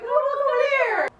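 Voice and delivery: very high cartoon whine; talking eye. Voicing the other wandering eye.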